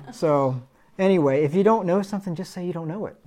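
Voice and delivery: ordinary talk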